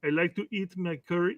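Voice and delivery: lightly attempted Indian-German accent; put-on Indian-German accent. Co-host mocks Derek's accents, half-hearted Indian-German accent. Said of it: "The guy can be Indian and still have a German accent".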